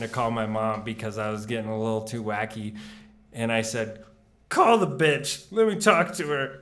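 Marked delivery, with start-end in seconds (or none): 4.5-6.6 put-on defiant drunk yell. Drunk retort, retold.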